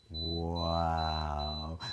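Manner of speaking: low, hushed, drawn-out wow of wonder; amazed wow. Shown as a demonstration. Showing a child how to react to the beep, hushed amazed wow. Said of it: "it sounds just like this".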